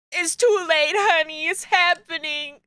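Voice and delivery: mock tearful dad. Voices tearful dad in game.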